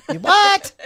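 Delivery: loud, shooting up high; mock shock. Host learns co-host didn't know the fact, mock shock. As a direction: squawk it in exaggerated disbelief.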